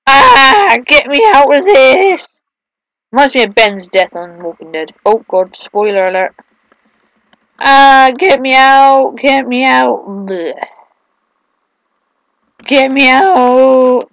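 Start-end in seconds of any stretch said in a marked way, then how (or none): 0.1-2.3 slow, loud hammed-up pleading; mock panic. Trapped, crying out. 7.6-14.1 slow, drawn-out hammed-up plea; mock pleading.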